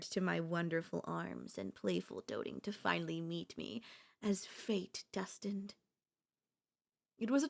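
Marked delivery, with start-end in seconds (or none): 0.0-5.8 whisper. Acted. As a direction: hush it like a secret of fate. Possessive girlfriend, intimate confession.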